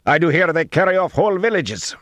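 high, loud, alarmed accusation; agitated high-pitched yell; calling out. A narrator's reading. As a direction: shout the rumour across a distance. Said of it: "another called".

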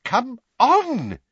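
high, loud exasperated cry; impatient. A narrator's reading. Weasley jabbing at the lift button, impatient. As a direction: urge it on impatiently. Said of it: "jabbed impatiently at the down button".

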